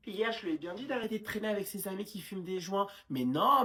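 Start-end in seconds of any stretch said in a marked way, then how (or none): 3.1-3.7 louder outburst of denial; loud protest. Acted. Mother loudly brushes off the nurse's worry. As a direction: cut her off loudly.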